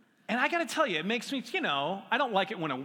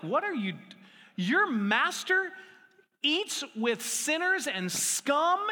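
high, loud and slow, scandalized; outraged accusation, drawn out; indignant priests; 10 semitones higher, 4 dB louder, slower than usual. Retold or imitated. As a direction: priests appalled at Jesus eating with sinners, loud and high. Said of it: "The high priests were like".

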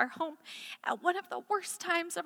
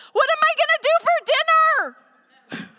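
put-on much higher, louder worried child; mock child; 14 semitones higher, 10 dB louder than usual. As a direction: play a fretting kid. Child voiced, preached point.

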